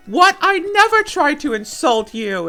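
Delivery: high, loud protest of innocence; mock indignant. Opponent denying they turned to insults, mock indignant.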